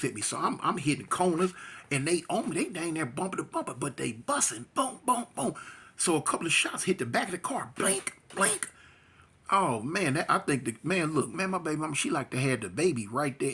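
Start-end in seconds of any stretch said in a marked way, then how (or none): 4.7-5.5 quick mouthed booms; gunshot sounds. Retold or imitated. Narrator mimicking gunfire behind the car, mouthed booms. 7.8-8.7 high sharp mouthed pings; bullet impact sounds. Retold or imitated. Narrator mimicking bullets hitting the car, high pings.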